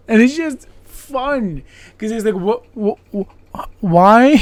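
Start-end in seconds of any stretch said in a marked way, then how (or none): 2.3-4.3 stammering, rising to a loud high why; sputter, then blurt the why out; baffled outburst. Retold or imitated. Host baffled by the sailor, loud sputtering why.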